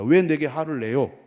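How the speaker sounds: loud acted challenge from a wife; confronting. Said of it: "이렇게 따졌어요".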